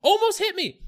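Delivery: high, loud outraged shout; re-enacted road-rage outburst; indignant yell. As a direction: yell it at the drivers who cut you off. Acted yelling at careless drivers.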